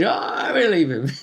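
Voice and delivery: amused imitation of a credulous player; mock player quote. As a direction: quote them lightly, then giggle. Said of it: "They just said".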